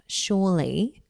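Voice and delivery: ordinary talk, nothing marked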